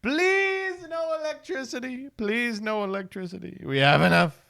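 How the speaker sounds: very high panicked begging that falls off; mock terrified pleading. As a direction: plead like the shark is coming. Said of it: "right before I grab the terminal".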